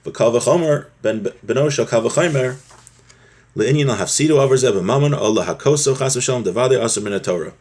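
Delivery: ordinary talk, nothing marked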